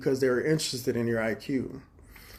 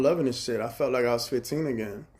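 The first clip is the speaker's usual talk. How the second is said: usual speaking voice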